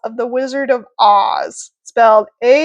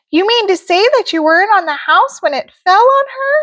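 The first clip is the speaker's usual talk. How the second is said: high, sweet fairy voice; sing-song good witch; Glenda the good witch; 9 semitones higher, faster than usual. Acted. Glenda asks.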